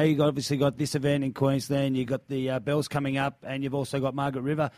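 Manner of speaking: usual speaking voice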